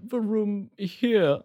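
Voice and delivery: high, slow little bear voice; high, drawn-out reluctant reply; cowed bear. Acted. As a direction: answer meekly as a sorry stuffed bear.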